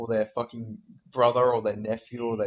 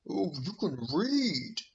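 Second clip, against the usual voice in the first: slow, higher put-on voice; mocking slow talker; 5 semitones higher, 4 dB quieter, slower than usual. Mocking the way the fighter talks, slow put-on voice. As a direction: mimic the fighter with drawn-out words. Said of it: "fucking the way he talks".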